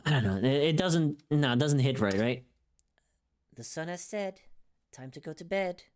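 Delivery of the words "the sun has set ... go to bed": soft, hushed and soothing; a much softer voice-line take, coaxing someone to rest; soothing bedtime voice. Shown as a demonstration. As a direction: gently ease a listener toward sleep. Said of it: "I'm trying to get something soothing going".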